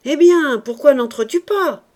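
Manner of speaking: very loud, high, indignant question; furious call after someone leaving; angry shout. A narrator's reading. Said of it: "cria du fond de la cahute avec colère".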